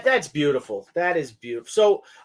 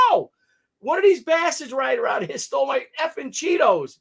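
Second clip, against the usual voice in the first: mock shout; 7 semitones higher than usual. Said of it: "I'm like".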